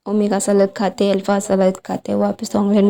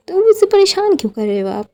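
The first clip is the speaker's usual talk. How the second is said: pleading son; 10 semitones higher, faster than usual. Acted. Songlin pleads with his mother.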